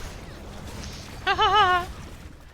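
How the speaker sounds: high, panicky giggle; nervous laugh. Real, not acted. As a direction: laugh off the fright. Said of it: "I really, really hate spiders".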